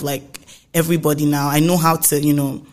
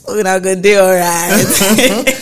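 slow, louder, laughing through it; 2 semitones higher, 7 dB louder, slower than usual. Retold or imitated. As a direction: say it while cracking up. Quote said laughing.

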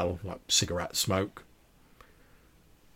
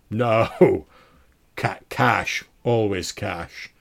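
loud, laughing refusal; booming laugh; 10 dB louder, much slower than usual. Acted. Deep-voiced baron insisting on cash to his tailor, booming. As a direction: boom it out grandly with a laugh. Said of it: "deep, booming laugh".

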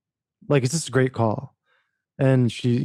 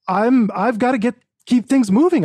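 heated retelling; 9 semitones higher, 4 dB louder than usual. Retold or imitated. Retells pleading to editor.

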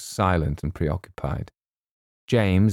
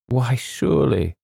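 slow, higher-pitched surprise; mildly taken-aback Colonel; surprised; 6 semitones higher than usual. A narrator's reading. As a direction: draw it out as if it were obvious. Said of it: "said Colonel Carteret, surprised".

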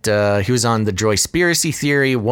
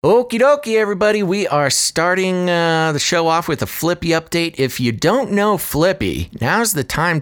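excited host; 6 semitones higher than usual. Acted. Segment intro, high and loud.

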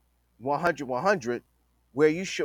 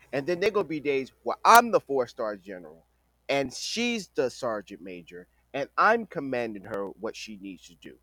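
ordinary delivery

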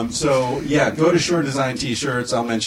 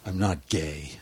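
deadpan quote; 9 semitones lower, 8 dB quieter, slower than usual. Retold or imitated. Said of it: "the guy says".

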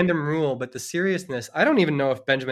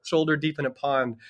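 normal talking voice

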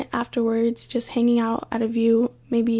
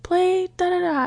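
mild mock yell; 7 semitones higher than usual. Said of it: "this woman yells out".

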